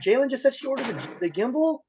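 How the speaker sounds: high, breathless thrilled report; can't believe the news, squealing it out; overjoyed high announcement; excited squeal. Real, not acted. Excited retelling of donation.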